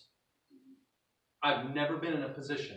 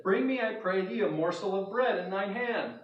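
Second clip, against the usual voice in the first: loud, high commanding call; Elijah's demand; 5 semitones higher, 5 dB louder than usual. Acted. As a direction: demand the bread boldly. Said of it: "he spoke to her again and said".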